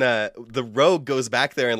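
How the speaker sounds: ordinary talk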